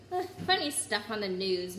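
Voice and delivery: usual speaking voice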